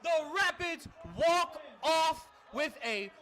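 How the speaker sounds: ecstatic play-by-play yell; excited shout. Real, not acted. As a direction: scream the winning kick call. Game-winning field goal call.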